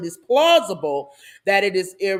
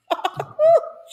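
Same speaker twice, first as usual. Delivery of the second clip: high burst of laughter; 5 dB louder than usual. Real, not acted. Hollering at the video.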